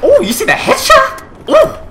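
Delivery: squeaky high amazed cry. Real, not acted. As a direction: shoot up in disbelief. Amazed at headshot.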